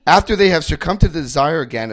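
usual speaking voice

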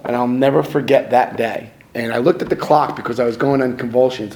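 ordinary delivery

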